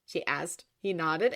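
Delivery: usual speaking voice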